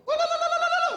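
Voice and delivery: high, loud panicked no; loud denial. Retold or imitated. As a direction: shout the nos high and panicked. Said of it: "They were like".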